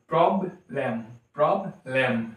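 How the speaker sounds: Problem in two beats, prob, lem; two separate syllables in problem. Shown as a demonstration. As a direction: split each problem after prob. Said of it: "disyllabic या monosyllabic".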